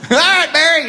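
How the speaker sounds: high, loud teasing cheer; rowdy drinking buddies cheering; gleeful taunt. Retold or imitated. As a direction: egg him on with glee. Crowd teasing Barry.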